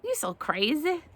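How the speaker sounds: high-voiced sassy rooster; Roscoe. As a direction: tease her in a high voice. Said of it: "Roscoe's like".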